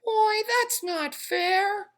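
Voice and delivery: high, slow whine of a tiny voice; whining pigtail. A narrator's reading. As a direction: sulk to guilt him. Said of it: "whined the pigtail".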